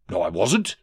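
usual speaking voice

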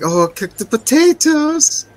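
high, slow mocking woman's voice; mock her in a high slow voice. Recapping a movie scene, mocking the woman in a high voice. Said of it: "And she's like".

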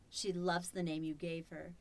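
normal talking voice

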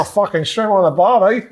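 hammed-up Australian bloke at the bar; Australian accent. Showing off his Australian accent to the lads, loud Aussie. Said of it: "He's an Australian accent then".

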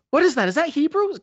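high, loud mock confusion; a friend hears Jonah speak a foreign language, in a high puzzled voice. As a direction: act baffled by the strange words.

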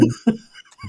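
a real, high, breathy laugh; high burst of laughter. Real, not acted. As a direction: let a laugh break out. Laugh between lines.

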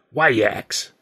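lightly voiced easygoing old trouper; a hint of a seasoned vaudeville comic, relaxed; mildly put-on voice of a cross-eyed film comic; comic actor Turpin. A narrator's reading.

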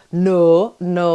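a light Yorkshire-Pakistani accent. Shown as a demonstration. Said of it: "and also I'll go".

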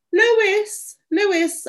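high, searching call; calling in vain. A narrator's reading. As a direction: call for the lost dog. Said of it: "I called in vain".

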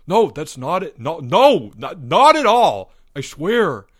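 loud, high, stammered denial; horrified. A narrator's reading. Albert protests Greg's accusation, in horror. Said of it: "said Albert in horror".

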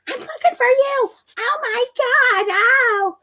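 very high hammed-up wail; exaggerated high-pitched imitation; mock distressed squeal. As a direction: squeal it like a melodramatic victim. Voicing the beaten woman's reply.

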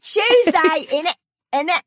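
high, laughing attempt at British; mock British accent. Traveler re-enacting attempts at British slang, mock British accent. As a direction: squeal out a silly British accent, cracking up. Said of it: "attempted a British accent".

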